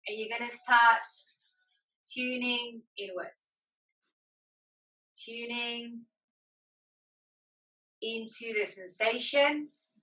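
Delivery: measured relaxation-class voice, leaving space to breathe; guided meditation. Acted. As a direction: guide them inward, phrase by phrase, unhurried. Leads yoga meditation.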